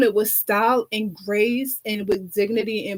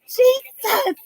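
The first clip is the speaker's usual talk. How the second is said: shrieked Jesus, way up high; mock-awed diva shriek; high exclaimed Jesus; 14 semitones higher than usual. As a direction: throw the name up high in mock awe. Diva reacting to the song.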